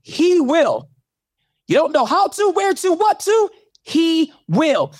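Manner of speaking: fervent pulpit crescendo, voice up high; preaching at full force. Real, not acted. Preaching build continues.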